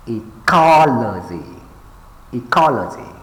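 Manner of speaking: ecology with COL punched, then falling; e-COL-ogy, leaning on COL; stress on second syllable. Shown as a demonstration. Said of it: "pay attention to the stress syllables".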